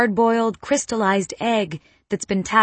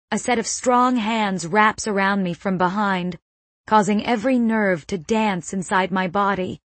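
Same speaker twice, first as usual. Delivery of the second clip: ordinary delivery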